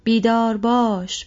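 slow, loud drawn-out alarm call on بیدار; rousing call. Acted. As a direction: call بیدار باش out long and loud like a horn. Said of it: "شیپور فراخوان باکلند". (فراخوان بلند و کشیده)

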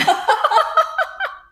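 高い声で笑い転げる; こらえきれない爆笑; 大笑い. Real, not acted. Bursts out laughing. (甲高く長めの笑い声)

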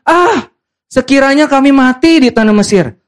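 high-pitched mock grumbling; sulking Israelites. Preacher plays sulking Israelites complaining, high mock huff. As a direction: wail the complaint in a hammed-up huff. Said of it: "orang Israel kalau ngambek kan".

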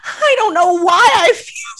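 high, loud, whiny imitation; mock tearful wail. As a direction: exaggerate a weepy, helpless wail. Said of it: "tearing up".

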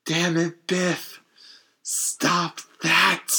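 lightly voiced nerdy pleading; a hint of a timid nerd protesting; George McFly. Timid guy telling off the bully, lightly nerdy protest. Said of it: "He goes full George McFly".

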